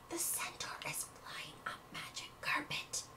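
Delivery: hushed, breathless whisper; awed crowd whisper. Acted. Onlookers whisper as the centaur rises, hushed.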